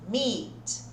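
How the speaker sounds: meat with a long "ee"; long I sound. Shown as a demonstration. Teacher models the second word, long ee. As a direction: stretch the í in "meat". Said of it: "Olha o som do I de novo".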